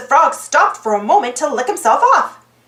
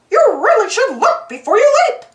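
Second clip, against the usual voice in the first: high, light cartoon mouse voice; Mickey voice; 7 semitones higher, 3 dB louder, faster than usual. A narrator's reading. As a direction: speak as a bright cheerful mouse. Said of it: "Mickey said to the frog".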